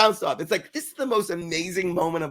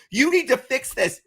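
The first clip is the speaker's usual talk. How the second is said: mock angry demand; 8 semitones higher, 4 dB louder than usual. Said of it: "She comes up angry".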